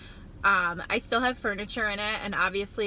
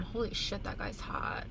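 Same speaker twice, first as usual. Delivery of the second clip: whispered aside; 9 dB quieter than usual. Real, not acted. Aside about passerby.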